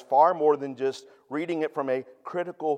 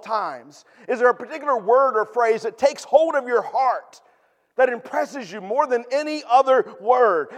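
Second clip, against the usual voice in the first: higher and louder, fervent sermon voice; preaching at full force; 6 semitones higher, 7 dB louder than usual. Real, not acted. Pastor pressing the congregation with questions, fervent preaching. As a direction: lift it up and press the questions home.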